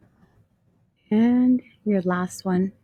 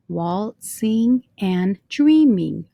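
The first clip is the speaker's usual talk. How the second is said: "Waltzing and dreaming" slowly split into single syllables; 4 dB louder, much slower than usual. Shown as a demonstration. Teacher showing line three has five syllables, syllable by syllable. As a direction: count out "Waltzing and dreaming" one slow syllable at a time. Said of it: "that's five".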